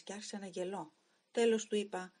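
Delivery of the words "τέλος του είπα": ordinary delivery, nothing marked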